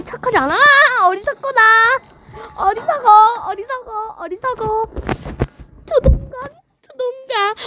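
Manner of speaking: high, gloating villain taunt; mock the enemy like a villain. (높은 목소리로 조롱하는 악당)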